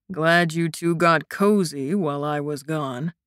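low, dry teasing; wry. A narrator's reading. Returning friend teasing the cozy pair, wry. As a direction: tease them wryly. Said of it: "came the wry reply".

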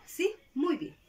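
ordinary talk

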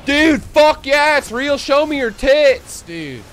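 high, loud, drawn-out hype bro; hyped fanboy voice. Retold or imitated. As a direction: scream it like a gullible superfan. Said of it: "small percentage of people in my chat".

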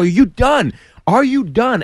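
high, insistent fight taunt; heated challenge. Retold or imitated. Comic relives demanding the man give up, high and heated. Demanding surrender while punching.

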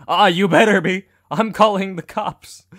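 loud, high-pitched acted warning; mock-alarmed threat. Warning the kidding creep about calling cops, mock-alarmed threat. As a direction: threaten loudly, pitched well up.

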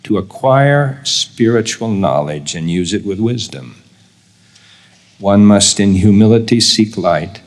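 deliberate reading, pausing between clauses; slow repeat. Real, not acted. Speaker repeats key statement for note-takers, slowly. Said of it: "I'm going to read it slowly".

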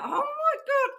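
high-pitched squealed reaction; mock panic. Medium retelling being told to go on stage, in mock panic. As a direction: squeal it high and loud, playing up the dread.

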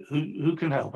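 ordinary talk, nothing marked

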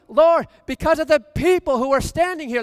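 high, loud prayer, leaning on the people; fervent prayer. Acted. Preacher voicing Jesus praying aloud, loud and fervent. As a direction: cry it out to the Father.

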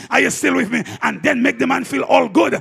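loud, fired-up sermon voice; full-force preaching. Acted. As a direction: preach it to the house at full volume.

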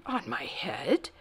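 soft, slow breath of disbelief; hushed, airy astonishment; gasped. A narrator's reading. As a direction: gasp the question in bewilderment. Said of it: "gasped Bartholomew".

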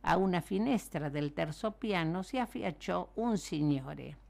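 ordinary delivery, nothing marked

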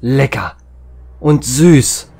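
immer lauter und höher vor Genuss; schwärmerisch anschwellend. Acted. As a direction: steigere dich ins Schmatzen-Glück. Genussvolles Schwärmen beim Essen. (Max verschlingt Melone, begeistert lauter werdend)

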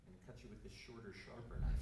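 a mock-menacing magician with a knife; sly aside. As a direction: slip the punchline in low. Joke reveal, much softer.

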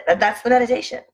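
normal talking voice

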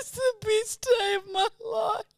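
high, slow tearful squeal; real crying-with-joy voice; happy near-tears. Real, not acted. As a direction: wail it with overwhelmed joy. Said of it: "I'm actually gonna cry".

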